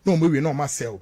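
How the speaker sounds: ordinary talk, nothing marked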